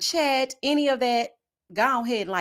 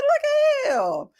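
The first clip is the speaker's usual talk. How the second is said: much higher, slow look at him; slow high mock wonder; amazed admiration; much slower than usual. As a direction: marvel at his outfit. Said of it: "I said".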